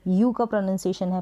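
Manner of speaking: normal talking voice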